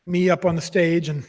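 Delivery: usual speaking voice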